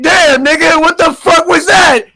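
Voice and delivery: loud, high startled yell; shocked shout. Retold or imitated. Tuco yelling after Walter's explosion, high startled shout. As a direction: yell it like a blast just went off.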